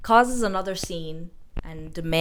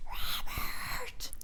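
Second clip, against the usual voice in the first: whispered name, no voice at all; worried whisper; 7 dB quieter than usual. Retold or imitated. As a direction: hiss his name out of sight, anxious. She's worried for the girls.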